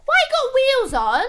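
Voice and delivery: put-on high, loud little-boy squeal of a question. Little boy down the corridor asking her, high mimicked child voice. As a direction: imitate a small child piping up loudly. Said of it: "a very sweet little boy".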